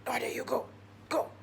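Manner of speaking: soft, under-the-breath nudge; furtive urging. Retold or imitated. Storyteller passing the task to colleague, furtive urging. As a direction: quietly push a colleague to go.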